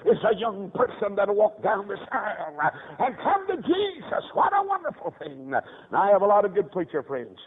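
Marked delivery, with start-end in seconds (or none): 3.0-5.5 high, ringing preacher's cry; impassioned preaching. Real, not acted. Preacher rejoicing at young people coming to Jesus, impassioned. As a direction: lift it high in exultation.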